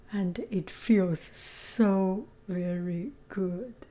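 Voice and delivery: whispered, slow and hoarse; barely any voice left. Real, not acted. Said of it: "I don't think I have a good voice".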